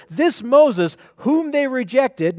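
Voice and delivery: slow, with weight on This Moses; emphatic this Moses. Shown as a demonstration. Preacher reading Stephen's words to the congregation, slow emphatic stress. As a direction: lean hard on This Moses, slowly. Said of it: "it's very emphatic in the Greek".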